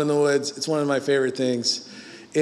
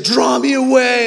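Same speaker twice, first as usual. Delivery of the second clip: higher, louder eager cry; excited; 7 semitones higher, 6 dB louder than usual. Retold or imitated. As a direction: call it out eagerly. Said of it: "She's like".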